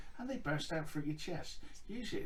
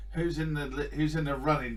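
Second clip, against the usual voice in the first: announcer voice; 3 semitones higher, 7 dB louder than usual. Acted. Hosting announcement.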